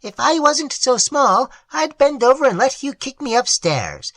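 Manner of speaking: high, tiny troll voice pleading; a small creature telling its woes. A narrator's reading. Troll explaining its loneliness to Prindle, high and small.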